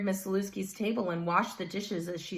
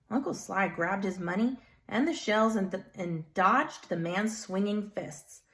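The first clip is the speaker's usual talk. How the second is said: ordinary delivery, nothing marked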